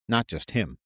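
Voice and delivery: ordinary delivery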